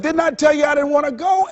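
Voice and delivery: a high, scolding mock voice for the heart. Heart scolding the Monday worker, high mock scold. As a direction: play the heart nagging its owner. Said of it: "The heart said".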